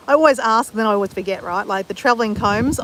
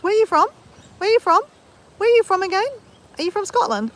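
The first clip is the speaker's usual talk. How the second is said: mock eager asking, pitched much higher; imitating herself asking; 10 semitones higher, faster than usual. Imitating herself asking viewers, high and eager. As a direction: rattle off the same question again and again. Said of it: "be used to me asking a million times".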